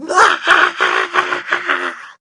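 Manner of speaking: put-on high, pulsing child's wail; hammed-up crying fit; loud bawling wail. A narrator's reading. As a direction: bawl at full volume, tapering off. Taya bursts out crying.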